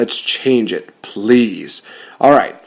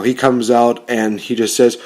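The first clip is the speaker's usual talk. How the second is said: ordinary talk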